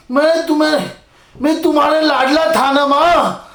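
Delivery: ordinary talk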